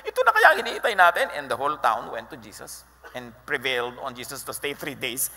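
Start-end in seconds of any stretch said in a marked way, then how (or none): 0.1-1.3 high, laughing, breathless with news; woman at the well telling the townspeople, excitedly. Acted. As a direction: burst out laughing with excitement, racing at the end. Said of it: "sinabi niya sa mga taong bayan".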